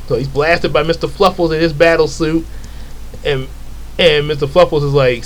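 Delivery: normal talking voice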